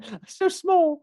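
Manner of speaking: very high, drawn-out tiny voice; slow, squeaky mock self-pity; little-guy voice. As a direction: echo the helpless bit through laughter. Joining in the little-guy bit.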